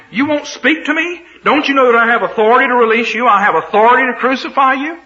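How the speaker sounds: high, loud and fast, scared man pushing his authority; frightened bluster. Acted. Pilate blusters at silent Jesus, high, loud and rushed. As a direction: bluff hard to hide the fear. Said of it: "He's terrified".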